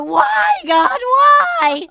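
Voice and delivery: high, loud wail to the heavens; anguished cry. Retold or imitated. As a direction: cry out to God in mock despair. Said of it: "halfway through you go".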